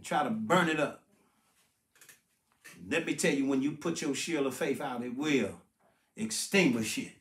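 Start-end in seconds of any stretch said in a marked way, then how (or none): none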